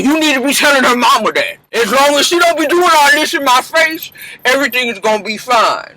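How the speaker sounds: sassy Mama Dap. Acted. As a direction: snap back sassy and quick. Mama Dap answers about civility.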